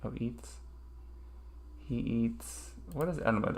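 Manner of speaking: usual speaking voice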